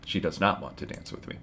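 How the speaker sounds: ordinary talk, nothing marked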